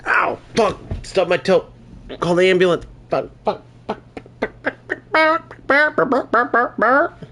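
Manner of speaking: high, strained swearing after a stubbed toe; pained cursing. Real, not acted. Stubbed his toe, swearing at the pain in a high, strained voice. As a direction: curse through the sting of a stubbed toe.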